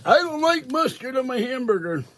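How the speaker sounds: high whiny mimic of a fussy passenger; mocking Kathy. Narrator mimics Kathy whining from the back of the van, high and whiny. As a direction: pitch it way up and whine like a picky eater. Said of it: "Kathy starts up in the back".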